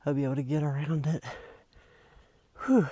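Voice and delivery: winded after a climb; puffing between words; out of breath. Real, not acted. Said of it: "I'm winded".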